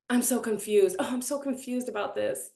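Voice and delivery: mock bewildered complaint; hammed-up helpless confusion; theatrical confusion. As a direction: play up being lost, for show. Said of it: "it was almost for, like, theatrics".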